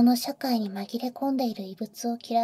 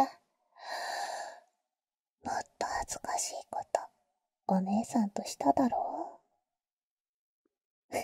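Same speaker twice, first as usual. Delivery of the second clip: teasing whisper; 7 dB quieter than usual. Acted. Older sister teasing the boy in his ear, whisper.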